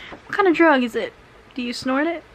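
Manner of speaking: ordinary talk, nothing marked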